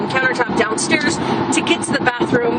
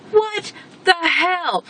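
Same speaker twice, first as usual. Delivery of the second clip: slow, drawn-out disbelief, higher; disbelieving what the hell; 6 semitones higher, much slower than usual. Retold or imitated. Retelling the measurer's sloppy drawing, slow disbelief. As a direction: stretch it out in disbelief. Shocked at his schematics.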